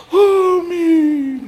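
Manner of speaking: very high, loud, drawn-out wail; hammed-up weepy squeal on the amen; mock tearful amen. Congregation hearing of donated food, mock tearful wail. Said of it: "Gembalanya nangis lagi".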